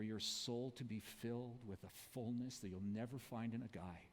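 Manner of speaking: low, soft and earnest; a preacher voicing Jesus, low and soft; tender Jesus. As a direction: speak it gently, a quiet offer of hope. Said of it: "He turns to her and says".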